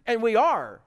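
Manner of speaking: loud, high-pitched burst of affirmation; emphatic cry, "are" high and loud; emphatic exclamation. Shown as a demonstration. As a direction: hit it hard with an exclamation point. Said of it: "That's how it is emphasized".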